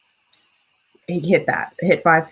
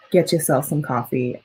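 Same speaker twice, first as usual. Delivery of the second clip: normal talking voice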